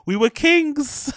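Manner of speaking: slow, high, hammed-up royal boast; mock proud proclamation. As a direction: drawl it like a mock king.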